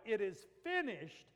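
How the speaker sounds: slow, high, loud shout; full-strength cry, each word stretched. Acted. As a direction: shout it as a drawn-out proclamation. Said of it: "He's shouting it out".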